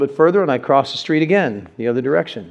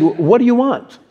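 "What do you want?" pitched higher; questioning inflection; 8 semitones higher than usual. Acted. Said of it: "I put the inflection on it".

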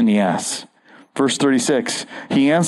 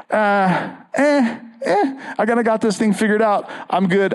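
high, quick, hammed-up dismissive voice; mock smug Pharisee; 8 semitones higher than usual. Preacher mocking the Pharisee who needs no Jesus, high and flip. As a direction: squeak out a cocky shrug, quickly. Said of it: "the Pharisee who says".

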